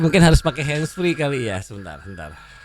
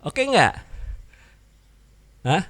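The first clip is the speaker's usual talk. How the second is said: normal talking voice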